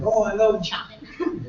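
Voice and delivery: high, slow affected enthusiasm; mock gushing. Composer fan giving easy answer, mock gushing. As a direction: draw it out high, parodying a gusher.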